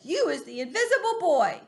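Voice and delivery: dad bursting with amazement; excited father. Acted. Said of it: "My daddy is squiffy with excitement".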